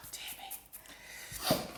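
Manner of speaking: soft unvoiced sigh of dismay; whispered dismay. Real, not acted. Cook muttering over too much oregano, whispered. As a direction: breathe it out to yourself, rueful.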